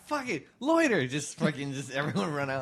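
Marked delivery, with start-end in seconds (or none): 0.1-1.1 high, tossed-off mock order; mock careless boss. Imagined boss giving up, high mock order. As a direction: fling out the order like you've given up. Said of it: "You're like".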